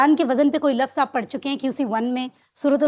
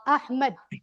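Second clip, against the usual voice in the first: usual speaking voice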